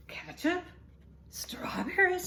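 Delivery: can't believe her ears; shocked disbelief. Acted. Mother shocked by food.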